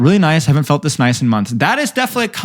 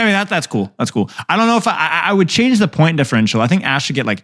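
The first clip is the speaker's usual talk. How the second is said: ordinary delivery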